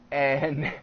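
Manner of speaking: ordinary talk, nothing marked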